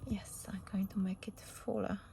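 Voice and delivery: soft whisper to herself. Real, not acted. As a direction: whisper while concentrating. Muttering to herself while crafting.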